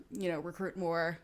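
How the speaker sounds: normal talking voice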